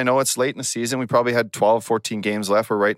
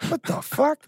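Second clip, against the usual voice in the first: much higher, squeaky mock disbelief; shocked outburst; 3 dB quieter, slower than usual. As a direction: pitch it way up in stunned disbelief. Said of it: "And I'm like".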